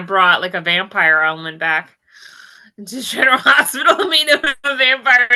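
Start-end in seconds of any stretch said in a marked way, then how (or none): 2.8-5.3 loud, laughing through the words. Real, not acted. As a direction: laugh while you talk excitedly. Laughing at vampire idea.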